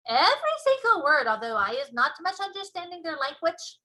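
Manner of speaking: loud boastful giant; booming giant voice. Acted. BFG excited.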